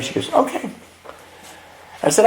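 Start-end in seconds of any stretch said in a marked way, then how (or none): 0.3-0.7 very high, soft little okay; imitating easygoing wife. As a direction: agree sweetly and lightly, pitched way up. Wife's unbothered reply repeated.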